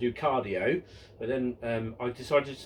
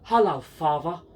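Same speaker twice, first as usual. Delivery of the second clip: slow, louder put-on Steptoe whine; Harold Steptoe impression; 6 dB louder than usual. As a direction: sneer at the old man like Harold Steptoe. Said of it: "Harold Steptoe goes to his father".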